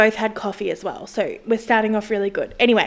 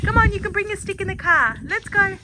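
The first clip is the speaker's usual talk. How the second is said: much higher pet-talk voice; coaxing the dog; 9 semitones higher than usual. Real, not acted. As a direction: coax the dog up high and sweet.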